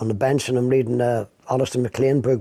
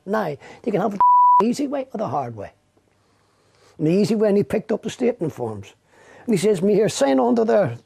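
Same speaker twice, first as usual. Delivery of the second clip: menacing detective; 7 semitones higher than usual. Retold or imitated. Retelling a detective's threat.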